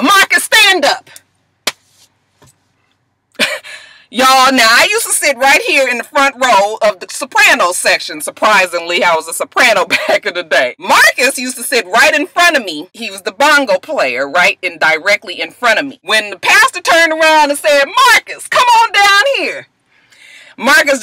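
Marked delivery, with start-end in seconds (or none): 0.0-1.1 pastor calling out. Retold or imitated. Pastor calling Marcus out publicly. 17.8-19.7 pastor's summons. Retold or imitated. Pastor summoning Marcus to front.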